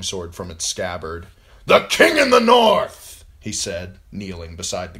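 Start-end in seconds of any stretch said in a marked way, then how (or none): 1.6-3.2 high, slow, loud acclamation; ringing rallying cry; proclaiming shout. Acted. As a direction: cry out your allegiance. Karstark proclaims the king.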